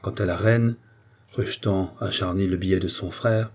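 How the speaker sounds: plain reading voice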